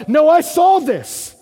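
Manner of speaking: loud high dawning cry; excited realization. Acted. Gospel writers realizing the psalm, excited shout. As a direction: shout it as the truth hits.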